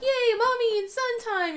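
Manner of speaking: high, slow singsong cheer; happy cheer. Retold or imitated. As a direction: sing out a delighted yay. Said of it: "I'm like".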